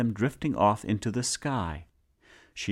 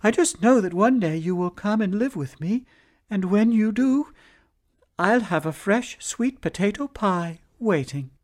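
much higher, sweet voice; cheerful wife; 10 semitones higher than usual. Acted. Wife comforting the sad man in the moon, high and sweet. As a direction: lift his spirits lovingly. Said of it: "the woman cheered him right up".

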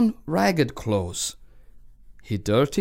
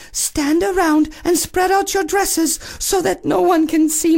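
cartoon princess; 13 semitones higher than usual. Princess speaks.

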